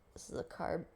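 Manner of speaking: softly whispered this is a carb. Shown as a demonstration. Pointing out the carb to viewers, in a whisper. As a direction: breathe it as a soft whisper. Repeats it under breath.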